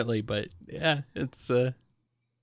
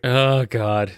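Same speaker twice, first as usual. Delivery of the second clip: exasperated shout; 4 dB louder than usual. Real, not acted. Groaning at the free parking rule.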